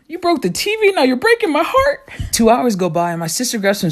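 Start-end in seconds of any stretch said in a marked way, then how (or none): 0.1-2.1 very high-pitched mock-hurt voice; hammed-up wounded falsetto comeback; mock heartbreak. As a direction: squeak it out in playful heartbreak. Mock-hurt comeback in sibling quarrel.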